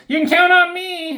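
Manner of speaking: very high, loud put-on voice; over-the-top high imitation of an eager underling; mock eager underling. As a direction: squeal it out as a mocking impression. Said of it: "Havoc's like".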